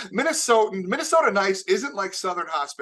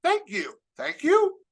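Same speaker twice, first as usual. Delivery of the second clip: confused thank you; 9 semitones higher, slower than usual. Pretend. Flustered victim answering a sweet insult, rising confusion. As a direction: pitch climbing much higher with each thank you. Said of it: "I don't know how to feel".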